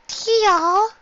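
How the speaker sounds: put-on very high child call; little girl voice. A narrator's reading. Little sick sister calls.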